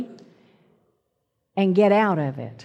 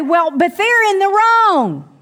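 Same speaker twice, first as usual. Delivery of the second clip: higher and louder, mock self-righteous protest; indignant objection; 9 semitones higher, 5 dB louder than usual. Preacher mimicking a proud listener objecting indignantly. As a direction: protest like someone sure they're right. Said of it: "Well, you say".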